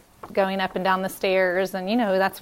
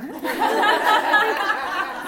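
laugh; 13 semitones higher than usual. Real, not acted. Laughs at her own story.

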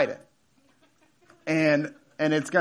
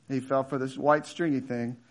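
scornful fish; 7 semitones lower, 7 dB quieter than usual. Acted. Watching fish mocks hooked fish.

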